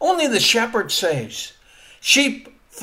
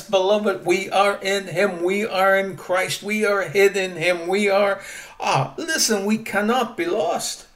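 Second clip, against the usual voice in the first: usual speaking voice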